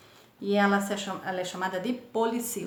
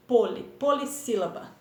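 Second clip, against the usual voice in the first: ordinary talk, nothing marked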